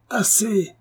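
coupe la parole d'un cri aigu et soudain; l'interrompre net, plus haut; cri furieux. Acted. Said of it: "s'écria l'Hercule". (le mari ivre coupe sa femme d'un cri)

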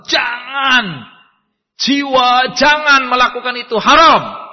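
loud, high cry of warning; urgent conscience. Acted. Conscience shouting at the tempted soul, loud and urgent. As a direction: plead hard against the sin.

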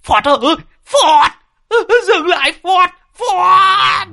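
high, loud panicked cries; frantic high wail; screaming. Acted. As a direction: shriek for the driver to stop. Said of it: "cùng rú lên".

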